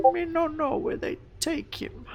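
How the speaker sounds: high, soft little creature voice; kobold reply. Acted. Kobold lost for his dragon's whereabouts, small high voice. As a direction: squeak it softly in simple creature speech. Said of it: "He goes".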